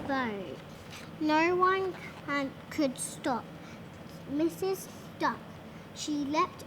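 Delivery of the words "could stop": ordinary delivery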